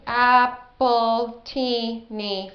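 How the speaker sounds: ap-ple-ti-ni stretched out; each beat of Appletini held long; slow syllable demo. Shown as a demonstration. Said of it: "Four syllables".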